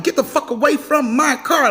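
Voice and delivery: high-pitched warning, as if honking each word; talking with horn. Retold or imitated. Driver blowing the horn at the child, high honking warning. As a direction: punch the words out like horn blasts. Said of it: "I'm blowing the horn, like".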